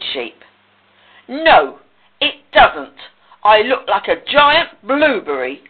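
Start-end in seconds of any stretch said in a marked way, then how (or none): 1.3-5.6 loud, emphatic outburst; hammed-up exasperated pig; indignant blurt. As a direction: blurt it out in protest. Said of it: "blurted Pig".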